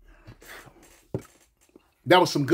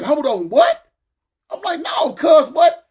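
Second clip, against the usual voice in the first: high, loud incredulous questioning; retold disbelief; 8 semitones higher, 5 dB louder, slower than usual. Looking at the homie who backed down, incredulous. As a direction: push the pitch up, can't believe it. Retells shock at homie backing down.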